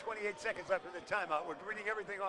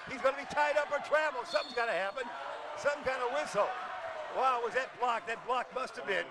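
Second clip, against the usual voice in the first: play-by-play; 3 semitones higher, 3 dB louder than usual. Acted. Live play-by-play.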